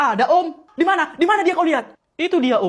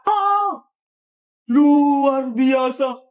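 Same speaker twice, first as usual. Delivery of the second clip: very slow, drawn-out, louder marvel; hammed-up awe; 4 dB louder, much slower than usual. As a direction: stretch it out in mock wonder. Exaggerated awe at the news. (kagum yang dilebih-lebihkan, pelan)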